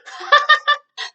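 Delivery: loud, high-pitched laugh; real laughing fit; burst of laughter. Real, not acted. Teacher breaks up mid explanation.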